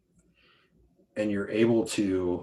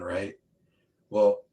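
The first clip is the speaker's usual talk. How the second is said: ordinary talk, nothing marked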